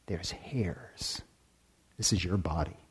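breathy whispered aside. Acted. Teacher to listeners, whispering a wonder. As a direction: drop to a secret whisper.

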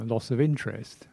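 usual speaking voice

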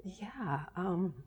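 choked up, barely getting words out; teary, holding back tears. Real, not acted. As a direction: voice fading into a whisper. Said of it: "this makes me teary".

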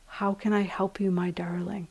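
hushed, tender near-whisper; Thich Nhat Hanh. Retold or imitated. Teacher quoting a master's offer of help, hushed and tender. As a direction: breathe it softly, simply and lovingly. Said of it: "this beautiful, simple voice of like".

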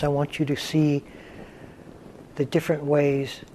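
unhurried meditation guidance with long pauses; guided meditation. Real, not acted. Teacher leading students into stillness, guided meditation voice. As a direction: guide them gently into stillness, leaving space between phrases.

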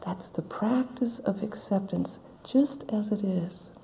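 soft, breathy near-whisper; hushed whisper. Real, not acted. Teacher closing a thought to the retreat, whispered. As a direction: let the words fall away quietly. Closing a reflective point softly.

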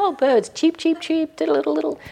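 high chirpy mock birdsong; sweet birdsong imitation. Teacher imitating pretty birdsong for the retreat group. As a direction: chirp it lightly like a pretty songbird. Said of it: "you think".

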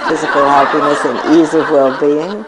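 blessing him through a laugh. Real, not acted. Wishing the pranked boy well, laughing. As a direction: let the laugh break into happiness.

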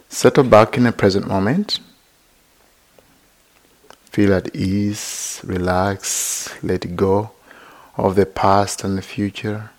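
unhurried, even-toned meditation teacher; guided meditation. Real, not acted. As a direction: gently guide listeners into body and breath, leaving long silences.

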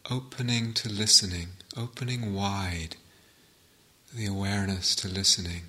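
calm meditation guide with long pauses between phrases; guided meditation. Real, not acted. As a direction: settle listeners into stillness, phrase by unhurried phrase.

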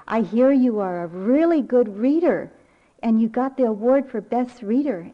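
loud, proud nana praise; excited praise. Retold or imitated. Grandmother praising granddaughter on the phone, excited praise.

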